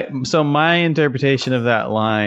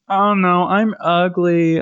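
mock insecure whine; 7 semitones higher, slower than usual. Mocking insecure modern songs.

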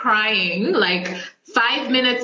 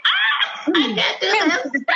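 high mock wail with laughter; laughing, hammed-up overwhelmed cry; retold crying; 9 semitones higher than usual. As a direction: squeal it like a playful crying fit. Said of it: "I was, like, crying before".

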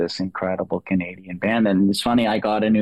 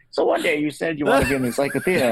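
laughing mock interviewer; 7 semitones higher, faster than usual. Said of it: "someone's like".

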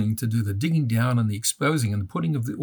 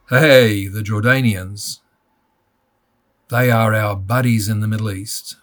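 booming, louder mock proclamation; much larger voice; 7 dB louder than usual. Father mimics US government loudly praising Jordanian allies. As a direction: announce it like a hearty public boast. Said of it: "in a much larger voice".

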